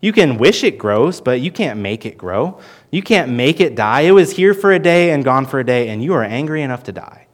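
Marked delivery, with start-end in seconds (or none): none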